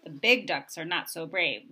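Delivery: usual speaking voice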